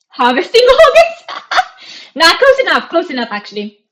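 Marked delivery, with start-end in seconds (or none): 0.1-1.4 much higher and louder, laughing out the question; surprised laugh. Real, not acted. Leest gekke vraag uit chat. 2.2-2.9 higher and louder through laughter; laughing. Real, not acted. Lacht om de vraag.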